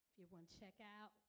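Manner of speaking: usual speaking voice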